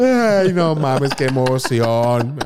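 drawn-out, hammy tearful wail; mock weeping. Single man learning he'll be a dad, mock weeping. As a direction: overcome with emotion, badly faked and dragged out. Mock weepy new father.

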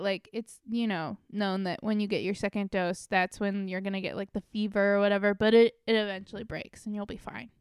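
ordinary delivery

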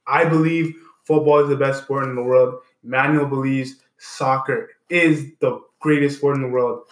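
loud, punchy host comeback after the break; show host announcing. Acted. Host welcoming listeners back, loud announcer energy. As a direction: pump up the listeners like a show host returning on air.

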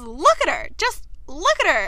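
acted exasperated telling-off; mock outrage. Anthony blaming Kate for her soaked sister, mock outrage.

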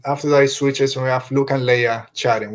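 ordinary talk, nothing marked

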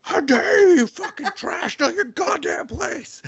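high hammed-up indignant guard; mock fury at the insolent soldier; mock outraged guard. Voicing the guard beating him.

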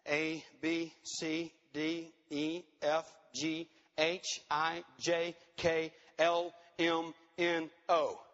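low, evenly spaced letters; measured, monotone roll call of letters; deliberate counting out. Shown as a demonstration. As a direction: tick off each letter slowly, with pauses. Counts out long list of integrity letters.

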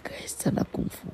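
hushed whisper. Acted. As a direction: whisper it like a secret. Gossipy storytelling aside.

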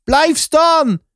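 high-pitched call to halt. A narrator's reading. Guard calling after someone walking away, high shout. Pursuer calls after fleeing narrator.

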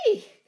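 short, loud startled screech; sharp shriek of alarm. A narrator's reading. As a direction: let out a sudden "eek". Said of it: "she screeched".

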